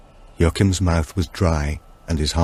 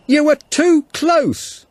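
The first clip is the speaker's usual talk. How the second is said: raised, high, slow call over a storm; said through the wind; 5 dB louder, slower than usual. Acted. Police officer Tilda calls to Martin across the blizzard, shouting. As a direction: shout each word against the gale. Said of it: "she said through the wind".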